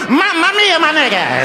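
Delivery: high, over-the-top Italian wail; mock Italian accent. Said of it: "not sure where the Italian comes from".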